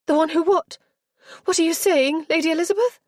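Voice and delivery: high, loud sharp demand; rounding on her. A narrator's reading. Girl demanding Lizzie explain herself, sharp. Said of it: "Richenda rounded on her".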